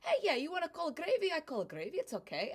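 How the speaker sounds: hammed-up easygoing Italian, giving in; Italian immigrant accent, cheerful; Vito agreeing. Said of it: "And he's like".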